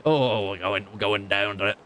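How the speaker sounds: put-on slipping British accent drifting into something else; half-hearted British accent that falls apart; failed British accent. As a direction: try a posh accent and lose it. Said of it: "that's not a British accent anymore".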